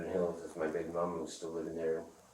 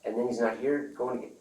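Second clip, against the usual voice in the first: retold angry rant; 7 semitones higher, 5 dB louder than usual. Retold anger at absent dealer.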